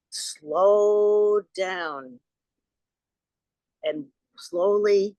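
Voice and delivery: drawn-out, stretched vowels on the slowing; slow-motion telling. Retold or imitated. Miming slow motion in retold story.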